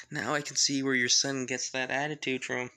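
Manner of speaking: acted chuckling around the words; amused, chuckle before and after the line; laughing. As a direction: tease through a put-on laugh. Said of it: "the Wizard King just laughs saying".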